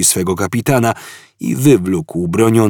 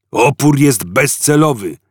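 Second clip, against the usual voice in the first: guard roaring; 6 semitones higher, 4 dB louder than usual. A narrator's reading. Guard to prisoners, a loud roaring bark. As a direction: stress on jest, loud bark. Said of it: "ryknął wartownik".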